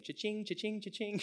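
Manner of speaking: high, sing-out cash register chime; gleeful money thoughts. Acted. Preacher voices Peter eyeing a big catch, gleeful money thoughts. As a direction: imitate a till ringing up, pitched well up. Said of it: "he's thinking".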